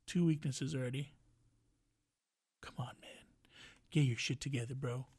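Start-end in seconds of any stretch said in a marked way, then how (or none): 2.6-3.1 breathy under-the-breath scold; whisper. Real, not acted. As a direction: whisper it, exasperated. Scolding Biden under his breath.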